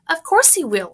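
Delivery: high, loud shriek. Acted. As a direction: shriek back at him. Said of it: "shrieked Missus Gloop".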